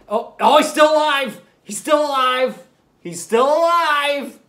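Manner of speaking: loud, high, urgent cry; excited shout. Pretend. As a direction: call it out like you found a survivor. Soldier found alive in game.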